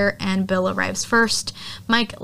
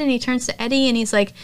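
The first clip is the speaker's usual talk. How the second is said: ordinary talk, nothing marked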